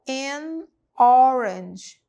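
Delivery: "an orange." very slow with a rounded open O; slow model with round O. Shown as a demonstration. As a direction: shape the lips round for "orange.". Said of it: "olha o formato da boca".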